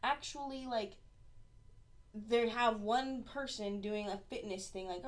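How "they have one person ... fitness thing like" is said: ordinary talk, nothing marked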